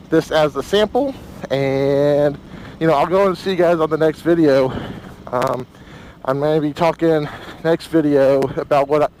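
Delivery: talking inside a helmet in the wind. Real, not acted. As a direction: voice through helmet mic on a ride. Riding with helmet-mounted camera.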